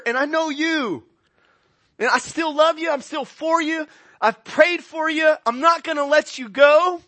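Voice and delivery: high, loud fervent preaching; preaching at full force. Acted. Preacher proclaiming Jesus' words to Peter with fervor. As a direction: drive it home to the congregation.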